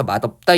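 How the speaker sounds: 맛없다 with ㅅ turned to d: 마덥따; representative sound, linked. Shown as a demonstration. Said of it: "이렇게 되는 거고요".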